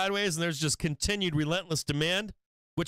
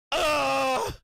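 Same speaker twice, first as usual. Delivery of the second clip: a sudden real loud frustrated shout; 8 semitones higher, 4 dB louder than usual. Real, not acted. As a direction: blurt it out, stung by the losing trade. Real frustration over lost trade.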